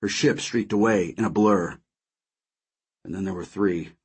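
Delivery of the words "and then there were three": low, soft mutter; subdued aside, lower and softer. A narrator's reading. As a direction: mutter it under your breath. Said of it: "I muttered over the comm".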